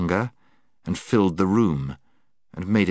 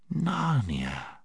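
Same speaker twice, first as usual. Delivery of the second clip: sweet quiet voice; 7 semitones higher, 6 dB quieter than usual. Acted. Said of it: "speaking in a sweet, quiet voice".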